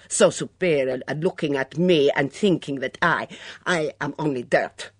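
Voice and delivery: put-on Mitzi, nervous then bitter, in a foreign accent. Mitzi voiced by narrator.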